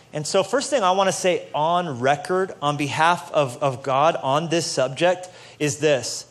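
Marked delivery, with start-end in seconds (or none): none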